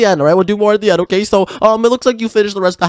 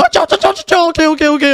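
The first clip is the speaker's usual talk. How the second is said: panicked pleading; 7 semitones higher than usual. Acted. Partner threatens to break house.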